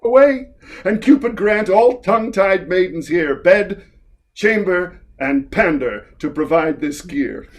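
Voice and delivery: theatrical verse delivery, lifting and swelling on key words; grand stage performance of old comic verse; dramatic play reading. Acted. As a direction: declaim it with relish, slowing into the flourish.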